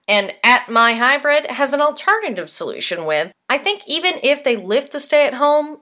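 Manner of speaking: lively, presentational host delivery; punchy scripted read with emphatic key words; scripted podcast news read. Real, not acted. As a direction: read it out like a soap news segment.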